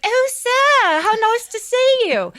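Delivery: very high falsetto greeting; wife suddenly sweet to Scrooge at the door; fake-sweet wife. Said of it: "she's like".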